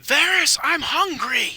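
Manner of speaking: loud, high-pitched holler to a guard; full-voiced demanding shout; yelling. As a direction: yell it down the hall. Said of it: "he, he yells".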